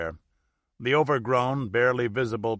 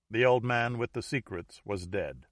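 ordinary delivery, nothing marked

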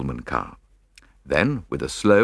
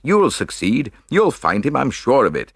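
higher, eager rallying voice; cried encouragement; 7 semitones higher, 4 dB louder than usual. A narrator's reading. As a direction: cheer on a despairing friend. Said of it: "I cried".

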